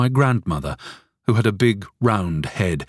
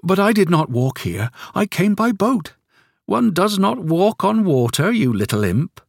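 put-on higher, playful old woman; grandmother teasing; 12 semitones higher than usual. A narrator's reading. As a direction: tease the boy fondly. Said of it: "she answered".

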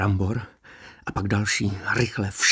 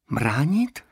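incredulous; 6 semitones higher, 5 dB louder than usual. A narrator's reading. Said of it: "opakoval pět set devítka klidněji".

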